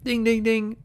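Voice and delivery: high mock alarm dinging; imitated alarm bell. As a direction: ring out like a warning bell, high-pitched. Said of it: "Double standard alarm".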